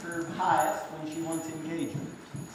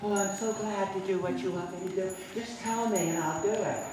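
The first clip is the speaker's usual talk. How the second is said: lightly voiced eager horse, softer; 2 semitones higher, 4 dB quieter than usual. Teacher voices a submissive horse eager to please, lightly voiced. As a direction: play a happy, willing horse. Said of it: "a horse licks and chews to show happiness and submission".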